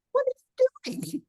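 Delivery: small mock scream as aching feet; hushed, comic voice of complaining feet. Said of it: "screaming at me, going".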